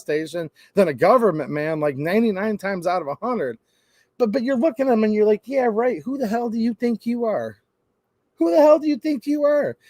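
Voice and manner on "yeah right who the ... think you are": high-pitched, indignant pushback; acted affronted disbelief; incredulous challenge. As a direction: scoff at someone pulling rank. Customer challenges the bossy clerk.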